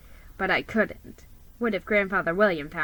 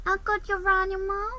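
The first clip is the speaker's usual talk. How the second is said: put-on much higher, small sad child; little boy voice; 11 semitones higher, 4 dB quieter than usual. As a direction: plead like a hurt little nephew. Child nephew's voice.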